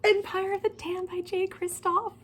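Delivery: high, slow awed announcement; reverent announcement. Real, not acted. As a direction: present the title like a holy relic.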